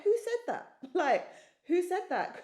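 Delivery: high, loud startled question; startled disbelief. Retold or imitated. Woman startled by words from inside herself, high-pitched disbelief. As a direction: recreate the shock, pitch leaping up. Startled by her own inner voice.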